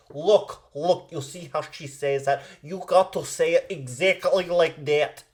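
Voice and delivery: hammed-up Russian mother coaching her daughter; Russian accent. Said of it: "let me do it in the Russian accent".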